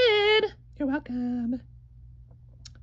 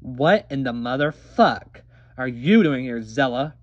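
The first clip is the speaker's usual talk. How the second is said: much lower, a growled greeting; deep villain voice; 4 dB louder than usual. Acted. Sister greeting rival Zella, low and gruff. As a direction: drop way down like a menacing sibling.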